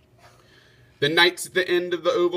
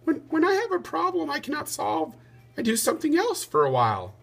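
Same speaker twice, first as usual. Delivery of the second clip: put-on high little boy's voice; a small son chiming in eagerly; piping child voice; 14 semitones higher than usual. A narrator's reading. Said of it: "Radius piped up".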